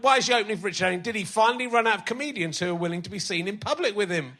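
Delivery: ordinary talk, nothing marked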